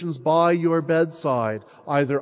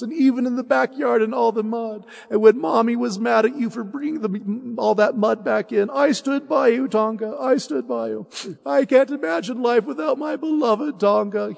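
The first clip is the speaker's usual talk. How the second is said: put-on a child's tender farewell, higher; 6 semitones higher than usual. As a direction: say goodbye to your dearest friend. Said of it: "he says".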